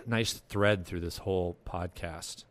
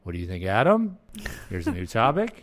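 last words ADAM and TOPIC swung up and louder; emphatic line endings; 5 dB louder than usual. Shown as a demonstration. Host demonstrating an annoying habit, exaggerated rising endings. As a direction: punch each line's final word with a rise. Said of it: "I end up like this".

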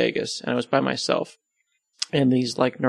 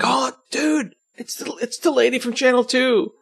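starstruck fan; 11 semitones higher, slower than usual. Retold or imitated. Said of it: "you're like".

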